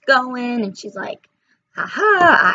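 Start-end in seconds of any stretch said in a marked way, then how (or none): 1.8-2.5 high, louder gleeful cackle; witch cackle. Acted. Witch on her broomstick spotting the piggies, high loud cackle.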